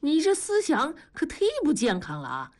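high-pitched political lecturing; righteous rebuke. Acted. Worker chiding a colleague's unhealthy thinking, righteous rebuke. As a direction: admonish him in a high, declaiming voice.

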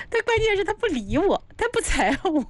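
high-pitched mock lovesick whine; lovesick complaint. Retelling Hu Tiehua's hopeless crush on the innkeeper, lovesick whine. Acting lovesick man chasing indifferent woman.

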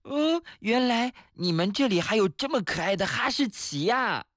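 high-pitched delighted exclamation; excited cry. Acted. Child spotting a cute dog in the mountains, excited cry. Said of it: "于是就大喊".

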